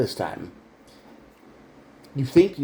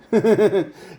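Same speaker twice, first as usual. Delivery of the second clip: short mocking laugh, higher and louder; 9 semitones higher, 5 dB louder than usual. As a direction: laugh at the fool. Mocking laugh after scoff.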